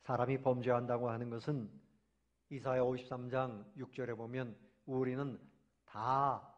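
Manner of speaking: ordinary talk, nothing marked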